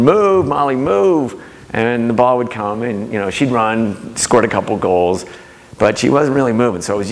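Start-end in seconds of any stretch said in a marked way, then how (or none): 0.0-1.4 high-pitched imitated yell from the sidelines; retold sideline yell. Dad to daughter at lacrosse game, retold yell. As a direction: call out urging, drawn up high, not full volume. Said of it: "And I'm yelling".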